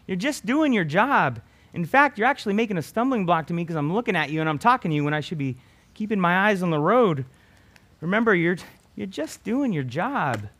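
loud, high retort to the signs; scolding the talking signs; shouting back. Pretend. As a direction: yell it back at them. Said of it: "you would shout at them and say".

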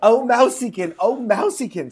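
a hint of a small girl calling; little girl. A narrator's reading. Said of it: "said the little girl".

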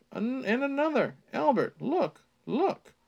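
high-pitched, halting alarm; gasped alarm. A narrator's reading. Character points out worms to Albert, gasping in alarm. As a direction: stumble in, then urge him to look, high. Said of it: "he gasped".

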